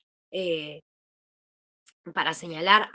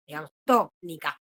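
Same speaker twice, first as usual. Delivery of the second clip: tónica with the first syllable TÓ marked and lower; stressed syllable demo; 4 semitones lower than usual. Shown as a demonstration. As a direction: lean on TÓ in tónica to show the tonic syllable. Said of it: "la palabra tónica es la to". (acento marcado en la sílaba TÓ de tónica)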